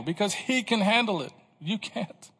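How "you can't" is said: ordinary delivery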